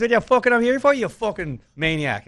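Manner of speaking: high, loud, incredulous yell from a local; rowdy Irish lad. Retold or imitated. Local lad in a Derry bar yelling at hitchhiking Kiwis, loud and incredulous. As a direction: play the young barfly baffled at the tourists. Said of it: "and he goes".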